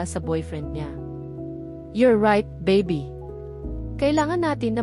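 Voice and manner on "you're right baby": plain reading voice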